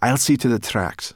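high, loud, pressing order; urgent hiss. A narrator's reading. Sorrel up a palm ordering Ben, urgent hiss. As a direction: take charge urgently from above. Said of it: "she hissed down to Ben".

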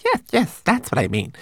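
high, jumpy little voice; nervously giggly character voice. A narrator's reading. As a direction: agree nervously, pitch popping up. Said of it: "Twigleg giggled nervously".

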